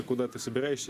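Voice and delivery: ordinary talk, nothing marked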